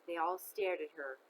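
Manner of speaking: ordinary delivery, nothing marked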